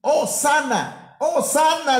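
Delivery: high, stretched acclaim of the crowd; acted crowd shout. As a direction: call it out like the crowd praising Christ. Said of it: "le gritaban a Cristo".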